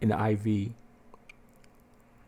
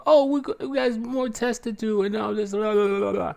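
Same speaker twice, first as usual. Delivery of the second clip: much higher mock brush-off; dismissive doctor; 10 semitones higher than usual. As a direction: wave away the patient's questions.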